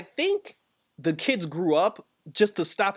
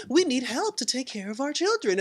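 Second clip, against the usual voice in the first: high imitation of a sitcom wife; Wanda; 9 semitones higher than usual. Wanda asking her husband for help with the children, high imitation. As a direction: plead in a put-on high voice. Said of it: "she's like".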